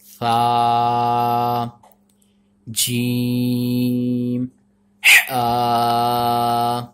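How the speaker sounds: सा said with no whistle; सा, जीम, आ drawn out in teaching recitation; letter recitation. Shown as a demonstration. Teacher demonstrates letters for children, drawn out. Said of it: "इसको हमने ऐसे ही पढ़ना है".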